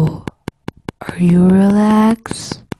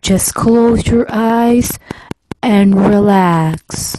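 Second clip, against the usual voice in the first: lower, slow, intimate ASMR murmur; 3 semitones lower, much slower than usual. Pretend. Vlogger demonstrating ASMR to viewers, lower slow intimate voice. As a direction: lull the listener to close their eyes.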